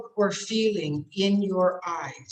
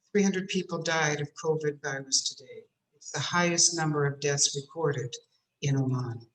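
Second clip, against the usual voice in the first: low, somber newsreader tone; grave news read; 6 semitones lower, 3 dB quieter than usual. Shown as a demonstration. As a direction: read it like tragic news with a heavy face. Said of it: "I'm not changing my voice. I'm changing my face".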